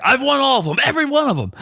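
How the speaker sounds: a rival getting in his face and bragging; cocky. Retold or imitated. Teen rival boasting to Kevin's face, cocky and high. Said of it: "his cockiness".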